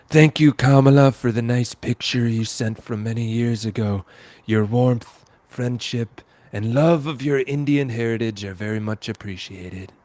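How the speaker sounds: reading Trump's post in a hammed-up voice, loud start; a touch of sarcastic sweetness; mock Trump post reading. Host reads Trump's post to cohost, mock-sweet.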